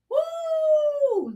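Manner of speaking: high, bright cheer of delight; joyful woo. Real, not acted. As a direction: let out a happy whoop for the new year. New Year greeting on livestream.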